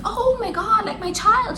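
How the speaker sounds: high-pitched imitation of a frantic mother; mock motherly panic. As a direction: overplay the panic in a high voice, without shouting. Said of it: "not, like, the panic".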